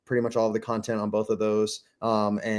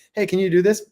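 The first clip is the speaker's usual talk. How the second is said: group project ask; 9 semitones higher, 7 dB louder than usual. Retold or imitated. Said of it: "that was like".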